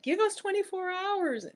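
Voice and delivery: high mock voice of a customer service rep; high sing-song imitation of a call centre brush-off. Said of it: "They're like".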